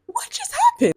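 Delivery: very high-pitched squeak of confusion; bewildered. Retold or imitated. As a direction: imitate a stunned, baffled child, pitch shot way up. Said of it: "I'm literally just so confused".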